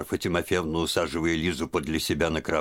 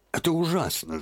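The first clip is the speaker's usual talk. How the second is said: put-on old woman's cry, much higher; distressed outburst; 12 semitones higher than usual. A narrator's reading. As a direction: burst out in despair, pitch way up. Aunt bursts out in distress. (отчаянный возглас старушки, высоко)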